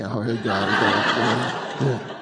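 low, soft, rumbling grumble; hammed-up indignant muttering under the breath; grumbled muttering. Someone stewing over a copied dress, low muttering. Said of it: "You're muttering, you're churning".

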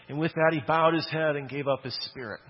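ordinary talk, nothing marked

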